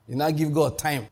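ordinary talk, nothing marked

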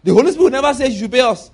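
tossing the claim back with scorn; mocking echo.

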